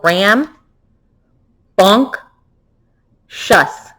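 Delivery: ram, bunk, shuss split into three separate beats; syllable by syllable. Shown as a demonstration. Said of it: "Can we say that in three?".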